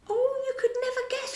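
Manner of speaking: very high, squeaky fairy voice; a frantic little fairy piping up; tiny fairy cry. A narrator's reading. Tinker Bell tells Peter the news in a squeaky fairy cry. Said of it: "she cried".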